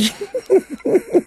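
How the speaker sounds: high, helpless laugh; high burst of laughter. Real, not acted. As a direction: crack up at the image. Laughs at friend in bed.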